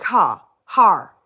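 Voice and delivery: "ha, har" with a flat open vowel and no r; saying "her" as "ha", then "har"; loud mock of the Corozal "her"; funny "her". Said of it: "they said the her funny".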